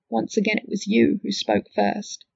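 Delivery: plain reading voice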